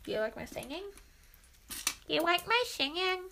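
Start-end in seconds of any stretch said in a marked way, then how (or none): 2.1-3.2 higher, slurred comic repeat; put-on silly voice. Fishing for praise on the singing, silly voice.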